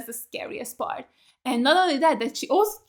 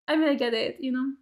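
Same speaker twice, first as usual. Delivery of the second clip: normal talking voice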